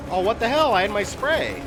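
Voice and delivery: sudden high, loud outburst of surprise; real surprise. Real, not acted. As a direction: caught off guard, yelp it out. Infected despite using spray.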